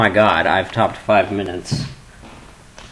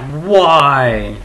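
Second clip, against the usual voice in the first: exasperated; 3 semitones higher, 7 dB louder than usual. Retold or imitated. Classmates' exasperated protest, retold.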